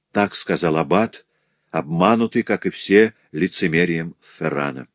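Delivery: plain reading voice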